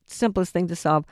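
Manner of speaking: ordinary talk, nothing marked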